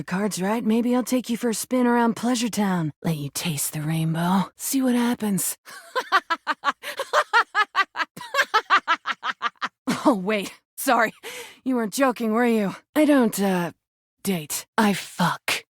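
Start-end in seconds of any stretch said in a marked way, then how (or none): 5.8-10.0 big mocking laugh. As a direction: burst out laughing at them. Mocking a confession of affection.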